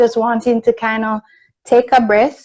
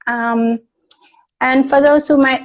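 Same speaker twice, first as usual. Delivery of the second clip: usual speaking voice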